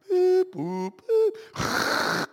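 put-on high beeping boop of an old modem; playful modem beeps; dial-up modem noise. Imitating dial-up modem sound.

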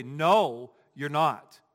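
loud, emphatic contradiction; punchy retort with pauses between phrases; standing firm. Shown as a demonstration. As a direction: stand up and flatly refuse to agree. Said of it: "stand up and say".

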